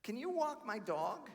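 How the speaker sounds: slow, lightly put-on sweet voice; imitating her. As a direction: drag out the odd request. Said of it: "She says".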